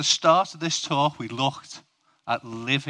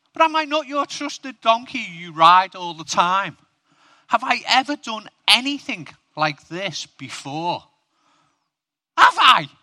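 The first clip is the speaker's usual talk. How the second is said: defensive donkey; 8 semitones higher, 6 dB louder than usual. Acted. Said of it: "The donkey's defending himself".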